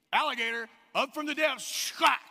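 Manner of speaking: loud, high, sudden storytelling burst; dramatic burst. A narrator's reading. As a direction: hit it like the gator strikes. Dramatic alligator attack in story.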